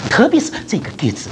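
usual speaking voice

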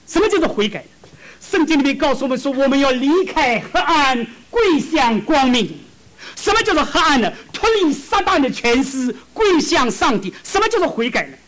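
fervent preaching. Acted. Preacher calling the church to repent, fervent proclamation. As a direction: proclaim it to the congregation.

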